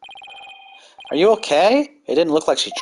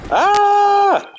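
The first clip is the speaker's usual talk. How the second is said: startled shriek; 4 dB louder than usual. Acted. Girl crashes into stuff.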